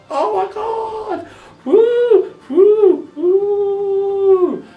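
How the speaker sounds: very high, drawn-out mock wail; hammed-up falsetto blubbering, whooping for composure; mock emotional breakdown. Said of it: "I had to compose myself there".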